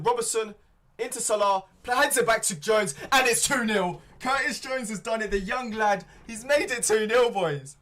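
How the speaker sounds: real thrill as his team scores; excited goal call. Real, not acted. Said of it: "I got a bit excited".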